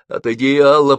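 настойчиво, выше обычного, с громким концом; попроси её не вмешиваться; настойчивая просьба. A narrator's reading. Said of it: "просил Бузыкин". (настойчивая просьба)

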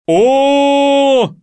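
long, high, very loud wail; вопль. Acted. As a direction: answer with a held, howling "ooo". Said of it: "завопил кто-то около меня". (протяжный громкий вопль)

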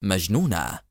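understated sternness, pitched low; stern. A narrator's reading. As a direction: drop the word in gravely. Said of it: "ثم أضاف في صرامة".

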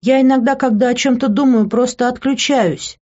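high plaintive wail, put on; whiny crying excuse. A narrator's reading. Woman pretending to cry to excuse herself, whiny pleading. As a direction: plead as if close to tears. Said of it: "Да не реви ты".

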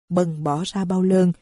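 ordinary delivery

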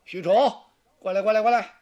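loud, beckoning call to a soldier; Xu You calling over. Acted. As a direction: summon him with lordly ease. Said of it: "他一招手". (许攸大声招呼许褚过来)

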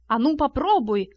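mild plucky call-out; a touch of brave defiance; brave shout. A narrator's reading. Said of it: "храбро выкрикнул Том". (слегка храбрый выкрик)

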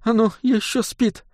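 high, soft, sobbing words; lady sobbing about sleeping child. A narrator's reading. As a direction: blurt through tears. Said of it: "всхлипнула дама".

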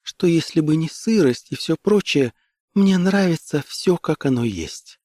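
high, gentle feminine voice; quiet tender woman. A narrator's reading. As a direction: start high and let it settle down at the end. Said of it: "сказала она тихо".

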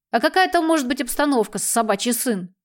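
ordinary delivery

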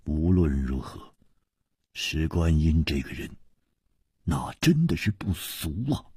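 soft, hushed inner praise; secret admiration. A narrator's reading. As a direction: marvel quietly to yourself. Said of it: "他的心里不禁暗暗的赞叹".

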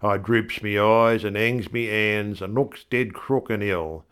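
ordinary delivery, nothing marked

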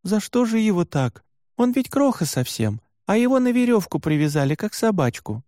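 high, distressed protest; upset, near tears. A narrator's reading. Varya protests the toddler being tied up, upset. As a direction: plead on the little one's behalf. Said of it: "Варя чуть не расплакалась".

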